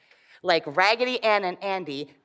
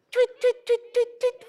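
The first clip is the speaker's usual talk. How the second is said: much higher chirping tweets; bird tweets. Shown as a demonstration. Showing the children how birds sound, high chirps. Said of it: "What do the birds sound like?".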